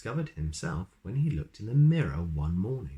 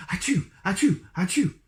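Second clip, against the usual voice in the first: three loud high sneezes in a row; 13 semitones higher, 7 dB louder than usual. Acted. Mister Sneeze sneezing three times on the wizard's cue. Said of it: "sneezed Mister Sneeze".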